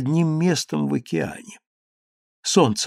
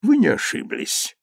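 solemn; 9 semitones higher than usual. Acted. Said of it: "торжественно подтвердил Сподовеккио".

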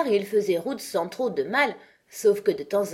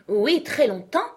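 raised, earnest insistence; very serious; 6 semitones higher, 5 dB louder than usual. A narrator's reading. Knight repeating his claim to Alice: earnest. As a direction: double down, dead serious. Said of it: "d'un ton extrêmement sérieux".

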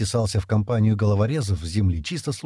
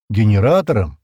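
louder, self-evident answer; matter-of-course; 8 dB louder than usual. A narrator's reading. Стэн answers Шира on defence, as self-evident. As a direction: state it as if obvious. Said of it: "как само собой разумеющееся".

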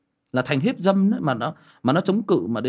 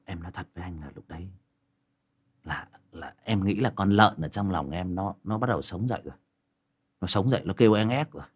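ordinary talk, nothing marked

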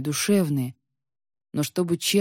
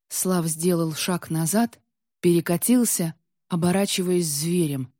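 ordinary delivery, nothing marked

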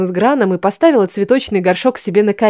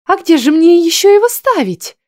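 put-on high girl voice; 9 semitones higher, 4 dB louder than usual. A narrator's reading. Darla waves it off.

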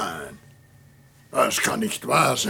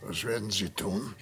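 leise Angst; 5 semitones lower, 10 dB quieter than usual. Acted. Todesangst vor der Pistole.

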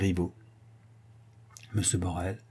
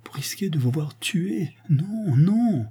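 rising, a little higher with alarm; altered, troubled voice; 7 semitones higher, slower than usual. Acted. Francine begs her lover not to risk the race, troubled and pleading. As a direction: plead against the danger, voice faltering. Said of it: "murmura madame Lambert d'une voix altérée".

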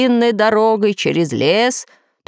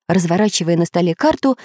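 ordinary delivery

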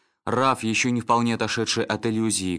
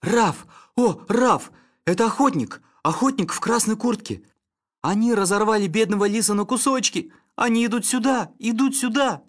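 much higher, loud panicked shrieks; terrified screaming; 13 semitones higher, 5 dB louder than usual. Acted. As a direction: scream in terror of the hunters. Snitter in seizure, terrified screams.

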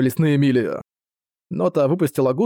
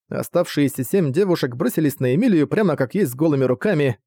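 usual speaking voice

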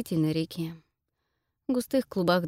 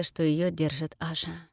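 usual speaking voice

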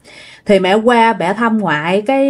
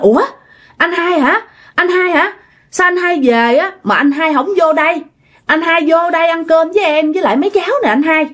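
higher-pitched grandmother calling to her brother; surprised questioning; 8 semitones higher than usual. Retold or imitated. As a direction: act out a puzzled, high-voiced invitation to come inside. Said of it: "bà ngoại mới hỏi rằng á là". (giọng ngạc nhiên, cao giọng gọi mời)

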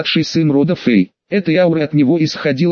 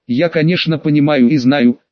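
plain reading voice

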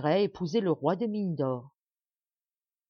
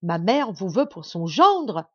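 slow, much louder shocked cry; outraged cry; 8 dB louder, slower than usual. Acted. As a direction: recoil in disbelief. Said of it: "s'écria". (cri indigné de la princesse)

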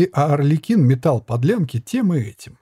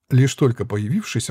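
ordinary delivery, nothing marked

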